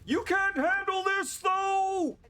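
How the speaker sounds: high, drawn-out, loud yell; shouted taunt. Acted. Player taunting the enemy after taking a scratch, high shouted taunt.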